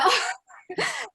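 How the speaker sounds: sudden high laugh; high burst of laughter. Real, not acted. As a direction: laugh out loud, high and bright. Laughing about quarantine with a child.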